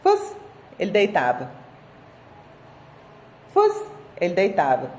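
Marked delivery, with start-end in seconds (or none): 0.0-0.4 high, sharp call of "Fus"; dog command. Shown as a demonstration. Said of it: "Gente, olha isso". 3.5-3.7 high, sharp call of "Fus"; dog command. Shown as a demonstration. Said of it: "Gente, olha isso".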